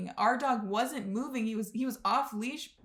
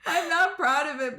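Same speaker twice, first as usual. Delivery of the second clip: laughing through the words, a bit louder; laughing confession; 6 semitones higher, 7 dB louder than usual. Real, not acted. Confessing she ghosted someone, laughing embarrassedly. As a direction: giggle out an embarrassed admission.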